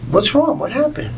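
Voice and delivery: high, worried mom asking after her son; mom's voice. Retold or imitated. Mom picking up her upset son, high and concerned. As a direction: play the fussing mother, well up high.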